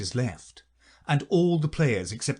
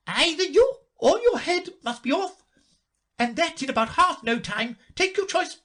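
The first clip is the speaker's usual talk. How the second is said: high, loud furious royal shout; furious queen; 3 dB louder than usual. A narrator's reading. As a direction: bellow it like a tyrant queen. Queen threatens the Duchess.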